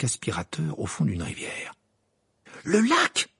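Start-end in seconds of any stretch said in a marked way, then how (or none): 2.6-3.3 much higher, louder cry; shouting. Acted. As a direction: point and cry out. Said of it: "s'écria Lee Jordan".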